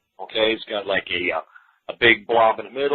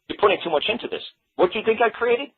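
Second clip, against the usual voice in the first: mock Picasso, exasperated; 10 semitones higher than usual. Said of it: "Picasso's gonna flip the painting".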